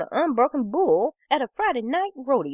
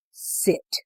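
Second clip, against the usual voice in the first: short, firm dog command. A narrator's reading. Red ordering the dog to sit, firm. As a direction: give one firm order, no extra volume. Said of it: "In a firm voice".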